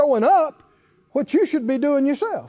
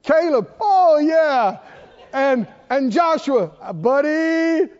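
higher, louder surprised realisation; sudden delight; 4 semitones higher, 3 dB louder, slower than usual. Retold or imitated. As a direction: react as if just remembering your name. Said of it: "They thought".

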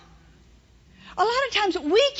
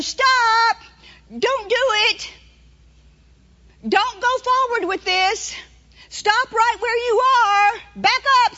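firm, louder command to stop; the Lord's warning; 5 semitones higher, 3 dB louder, slower than usual. Acted. Preacher voices the Lord stopping a purchase, loud and commanding. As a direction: speak as God halting a bad choice. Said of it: "That's the Lord telling you".

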